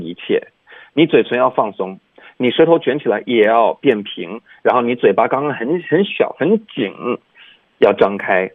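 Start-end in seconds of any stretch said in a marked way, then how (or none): none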